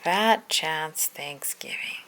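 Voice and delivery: slow unvoiced whisper of the title. Shown as a demonstration. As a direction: breathe the title slowly, no voice. Title read twice, second whispered.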